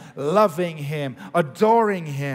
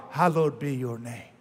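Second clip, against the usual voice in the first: soft reverent prayer; 6 semitones lower, 6 dB quieter than usual. Shown as a demonstration. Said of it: "You could do it".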